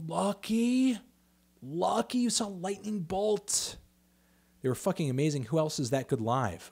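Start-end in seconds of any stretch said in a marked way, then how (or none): 0.1-3.8 high-pitched, drawn-out envious whine; mock-jealous squeal, slowed right down; playful envy. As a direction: stretch it out high, jealous of their luck. Envious reply to viewer's concert.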